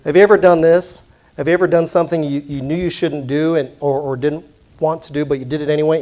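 ordinary delivery

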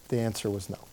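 dropped soft, letting the no sink in; quiet echo. Real, not acted.

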